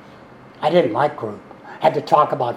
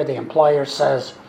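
normal talking voice

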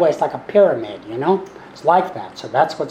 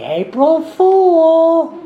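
very high, very slow singsong April Fool; teasing punchline; 6 dB louder, much slower than usual. Retold or imitated. Friend springing the joke on him, high, slow and gleeful. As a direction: stretch it out like a gleeful prankster.

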